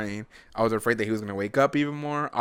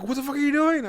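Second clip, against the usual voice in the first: shrill wife; 6 dB louder than usual. Retold or imitated. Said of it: "and then she's like".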